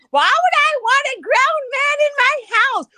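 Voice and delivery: loud, very high, drawn-out mimicry; mock protest. Mimicking a woman denying a man in her house, mock protest. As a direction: throw her denial back in a high, slow, exaggerated voice. Mockingly quoting the woman's denial.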